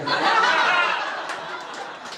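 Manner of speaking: airy laughter after the punchline; short whispered laugh; breathy laugh. Real, not acted. Laughs at his own joke.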